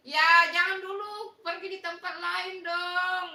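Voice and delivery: high, loud wail of protest; loud pleading protest. Real, not acted. Host begs viewers not to go elsewhere, loud pleading. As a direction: plead loudly, stretching it into a sing-song.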